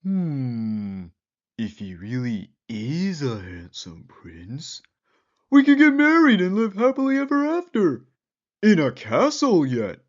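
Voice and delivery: high, fast, rising excitement; dreamy girl. Acted.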